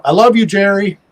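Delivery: mock yell, as if calling across a yard; retold yell. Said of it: "he yells out".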